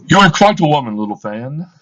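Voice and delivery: loud exclamation. Acted. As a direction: exclaim it fondly. Scrooge exclaims to little Fan.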